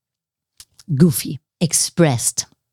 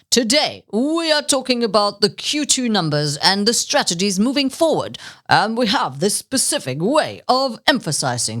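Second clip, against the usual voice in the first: stiff news-anchor cadence with odd stresses; seasoned presenter. Shown as a demonstration. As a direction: demo a detached, routine presenter read. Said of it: "a seasoned presenter".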